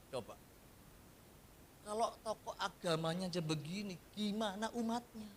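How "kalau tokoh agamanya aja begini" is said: usual speaking voice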